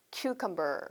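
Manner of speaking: cucumber said clearly as "kyu-kam-ber"; model word. Shown as a demonstration. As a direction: model cucumber for the class to repeat. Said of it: "한 번 더". (cucumber를 또박또박 시범 발음)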